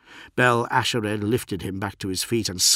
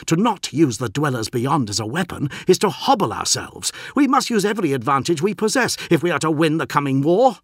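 higher, louder impassioned argument; defiant postulant; 8 semitones higher, 6 dB louder, faster than usual. A narrator's reading. Postulant argues with his captain, urgent and defiant. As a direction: press the case hard, gaining pace and pitch.

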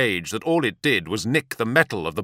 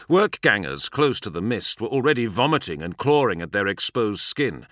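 ordinary delivery

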